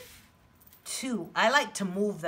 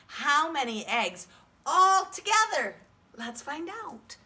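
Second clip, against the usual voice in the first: higher, louder call to the class; broad singsong kids theatre; 7 semitones higher, 5 dB louder than usual. Shown as a demonstration. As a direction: lead the class in unison. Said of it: "Can you say that with me?".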